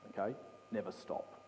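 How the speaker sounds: normal talking voice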